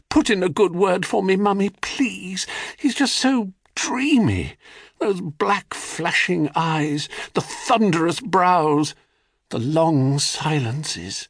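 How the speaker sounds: high, swooning and breathy-toned; dreamy lovestruck girl. A narrator's reading. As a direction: sigh it out like a girl in love. Rhea swooning over Kronos.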